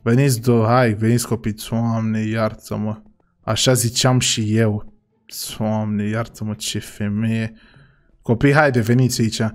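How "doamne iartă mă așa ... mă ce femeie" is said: softer, slow muttered aside; a quiet, disbelieving sigh of a line; exasperated mutter. Acted. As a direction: grumble it under your breath. Muttering aside after the headmistress.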